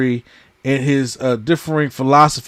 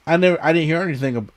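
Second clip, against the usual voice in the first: normal talking voice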